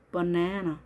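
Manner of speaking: "banana" as buh-NAE-nuh, middle vowel long; weak first and last vowels in "banana", strong middle ae; second-syllable stress. Shown as a demonstration. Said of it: "정확하게 발음해 주시면".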